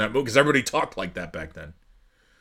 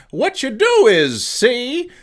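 put-on much higher, slow old-timer voice; Abe Simpson impression; 12 semitones higher, 4 dB louder, much slower than usual. Vlogger quoting Abe Simpson, higher old-man gangster drawl. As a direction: croak it like an old cartoon grandpa. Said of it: "where Abe Simpson says".

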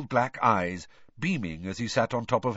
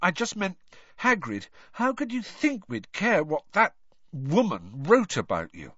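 higher-pitched, insistent schoolboy; Harry, earnest; 9 semitones higher than usual. A narrator's reading. Boy reassuring his upset friend, earnest and insistent. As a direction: plead earnestly with a hurt friend.

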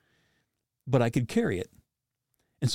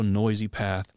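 normal talking voice

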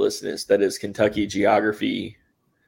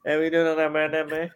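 slurred Southern drawl; 5 semitones higher than usual. Retold or imitated. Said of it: "it's basically syllables".

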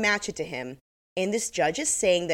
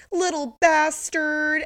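mimicking victim; 10 semitones higher, 4 dB louder, much slower than usual. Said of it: "Mascara goes".

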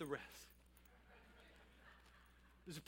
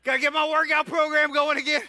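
higher, louder, building preacher's cry; pastor's rising call, louder and higher; full-force preaching; 7 semitones higher, 10 dB louder than usual. Acted. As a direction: lift the room, preach it out full voice.

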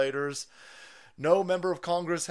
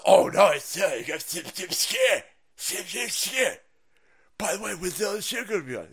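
mocking stammer; 5 semitones higher, 4 dB louder than usual. Mocking Stern's reply.

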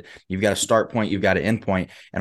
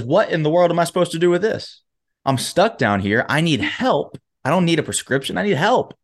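higher-pitched, acted-out indignant protest; exasperated plea; 7 semitones higher than usual. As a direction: plead in frustration, pitch jumping up on the demands. Trapped man protests useless prescription.

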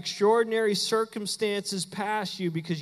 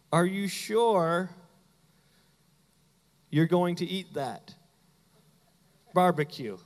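clarifying question. Acted. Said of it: "like, clarifying".

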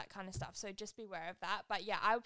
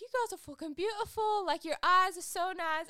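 high, loud put-on Manchester accent; hammed-up Manchester lad, pitched high and loud; strong UK accent; 10 semitones higher, 8 dB louder than usual. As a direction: imitate gushing lads with a strong British accent. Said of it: "a very strong U K accent".

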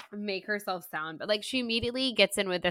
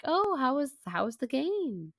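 higher, halting put-on small talk; feigned casual awkwardness; 8 semitones higher than usual. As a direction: stumble into small talk on a raised pitch. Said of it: "And she's just like".

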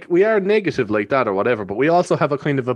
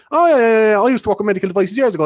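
fast, higher, louder chatter; talking going ninety; 8 semitones higher, 4 dB louder, faster than usual. Retold or imitated. Guard chatting to a stopped worker, fast and higher. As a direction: rattle it off at speed. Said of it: "he was talking going ninety".

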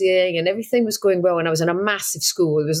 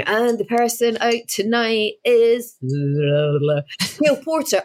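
slow, drawn-out eviction announcement; suspenseful mock announcer, name held long; imitating TV announcer; 3 semitones higher, much slower than usual. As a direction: stretch out the big reveal like a presenter. Voicing TV presenter announcing eviction.

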